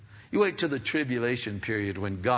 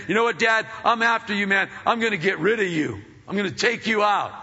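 put-on toddler's threat; 7 semitones higher, 4 dB louder, faster than usual. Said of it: "two-year-old son coming to dad and saying".

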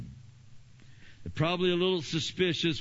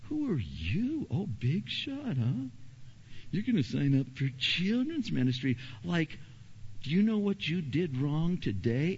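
mocking enemy voice; 6 semitones higher than usual. The enemy mocking a new volunteer, sneering high. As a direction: belittle them like a bully. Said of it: "And the enemy goes".

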